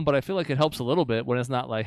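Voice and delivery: ordinary talk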